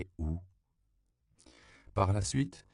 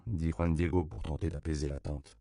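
ordinary delivery, nothing marked

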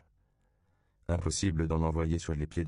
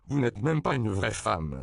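raised, indignant priest's reproach; indignation; 5 semitones higher, 4 dB louder than usual. A narrator's reading. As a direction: deliver it as an outraged rebuke. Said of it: "s'indigna le père Octavio". (ton indigné)

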